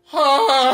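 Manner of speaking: pained gasp. Retold or imitated. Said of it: "I'm just sitting there just like".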